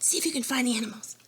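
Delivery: ordinary talk, nothing marked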